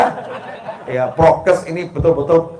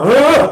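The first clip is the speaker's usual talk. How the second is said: mock angry huff; 9 semitones higher, 7 dB louder than usual. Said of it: "Walaupun sama".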